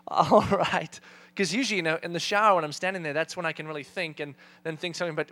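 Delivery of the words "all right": sighing, reluctant agreement. Retold or imitated. Husband agreeing to take the kids into the shower, reluctantly. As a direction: give in with a heavy breath first. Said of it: "I reluctantly kinda went".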